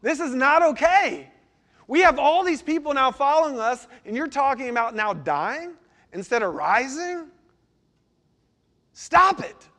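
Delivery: a disciple pushing back on his teacher, brash and loud; cocky Peter. Retold or imitated. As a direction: play cocky Peter telling his master off. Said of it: "And he's like".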